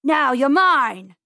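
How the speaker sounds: loud, drawn-out gloating shout; acted villain's victory cry; triumphant farmer's yell. A narrator's reading. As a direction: yell slowly as the farmer pouncing. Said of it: "yelled Mister McGregor".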